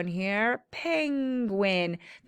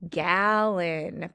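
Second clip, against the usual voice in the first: ordinary talk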